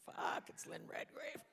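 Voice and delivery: drawn-out whisper of disbelief; stunned whisper. Retold or imitated. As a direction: whisper it slowly, like an awestruck onlooker. Cast's stunned reaction, retold.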